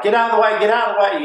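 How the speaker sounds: loud, high retold yelling; urgent shout. Ambulance driver yelling at traffic ahead, loud and urgent. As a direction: bark it like a driver clearing traffic. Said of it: "by his tone that, you know, it's a serious situation".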